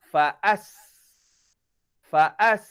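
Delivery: Faas with s at the front of the mouth; s sounded outside. Shown as a demonstration. Teacher showing Faas sounded forward. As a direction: keep Faas light and forward. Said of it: "Sin bunyi kat luar".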